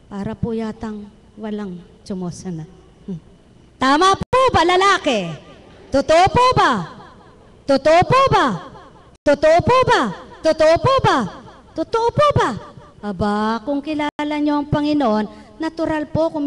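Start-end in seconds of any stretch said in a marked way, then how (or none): 3.8-12.5 fiery preacher call-and-response; preaching at full force. Acted. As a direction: rouse the congregation with ringing questions. Repeated call to congregation.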